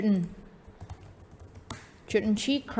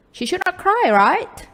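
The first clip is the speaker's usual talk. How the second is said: high, louder casual check ending on a rising RIGHT; informal tag question; 11 semitones higher, 7 dB louder, slower than usual. Shown as a demonstration. Teacher showing students the spoken version, high informal tag question. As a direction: say it informally, pitched well up, fishing for agreement. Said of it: "informal kita akan ngomongnya".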